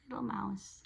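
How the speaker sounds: tender little whisper; soft affectionate whisper. Real, not acted. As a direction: whisper it fondly. Fond of mouse character.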